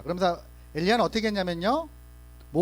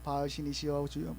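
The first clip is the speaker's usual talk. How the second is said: softly imitating the prophets calling on Baal; a hushed, half-hearted plea to 바알; mock prayer, quiet; 4 semitones lower, 7 dB quieter than usual. False prophets begin praying to Baal, murmured low. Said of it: "거기서 막 기도하는 거예요".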